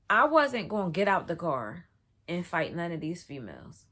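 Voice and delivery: ordinary delivery, nothing marked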